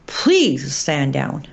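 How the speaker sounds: slow, low, insistent appeal; drawn-out, deliberate entreaty; earnest plea. Real, not acted. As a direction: plead slowly, leaning on please. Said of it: "this is a plea to President Trump".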